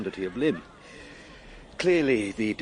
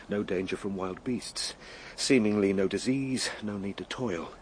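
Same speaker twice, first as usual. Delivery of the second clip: usual speaking voice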